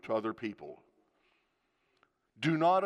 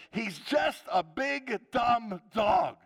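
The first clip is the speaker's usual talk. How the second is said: high, loud, drawn-out cry; exasperated outburst; 8 semitones higher, 4 dB louder, much slower than usual. Retold or imitated. Wife losing the argument about the dog, exasperated loud cry. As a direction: blurt it out in defeat.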